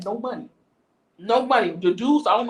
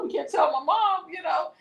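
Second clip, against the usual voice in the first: higher-pitched anxious protest; mild panic, pitch raised; retold worried plea; 7 semitones higher than usual. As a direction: re-enact a kid scared of getting in trouble. Retells panicked plea to hotel staff.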